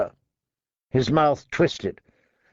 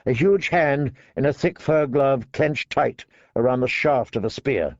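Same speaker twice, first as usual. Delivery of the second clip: plain reading voice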